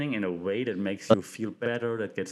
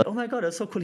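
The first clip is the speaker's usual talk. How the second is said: higher, gushing fan reaction; fan delight; 10 semitones higher, faster than usual. Retold or imitated. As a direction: gush like a thrilled fan.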